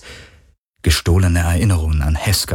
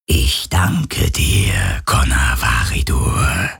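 tief, langsam und eisig geflüstert; kalte flüsternde Stimme; 7 semitones lower, much slower than usual. A narrator's reading. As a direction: wie ein kaltes Wesen aus dem All. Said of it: "sagte eine flüsternde Stimme, kalt wie das All". (eisig flüsternde Kreatur)

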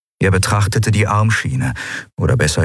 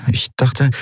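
tentative heroine; 7 semitones higher than usual. A narrator's reading. Endriel voiced, interrupted by Kero.

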